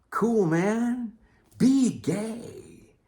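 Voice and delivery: very high, loud mock cheering; hammed-up approval. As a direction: call it out in a silly high voice. Acted high-pitched outburst to his critics.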